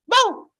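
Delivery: squeaky high bark from a cat; high mock bark. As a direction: yap it out like a tiny dog. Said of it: "It replied".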